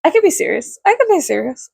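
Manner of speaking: laughing while claiming seriousness; can't keep a straight face. Real, not acted. Telling viewers she could be serious, laughing. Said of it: "I can't".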